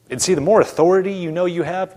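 ordinary talk, nothing marked

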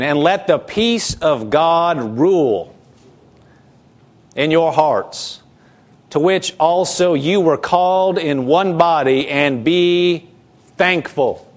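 slow, measured Bible reading; scripture reading. Acted. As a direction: read the verse deliberately to the church. Pastor reads scripture aloud.

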